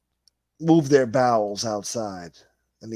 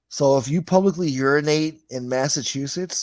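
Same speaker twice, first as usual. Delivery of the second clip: ordinary talk, nothing marked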